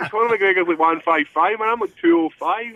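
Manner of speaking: mock reply. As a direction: pitch it up and push it. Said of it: "And I'm like".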